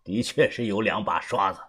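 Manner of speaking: low, soft character voice with a chuckle before it; quietly impressed older mentor; knowing elder, amused. Acted. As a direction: give grudging praise in a low, unhurried tone. Voices Uncle Xu, amused praise.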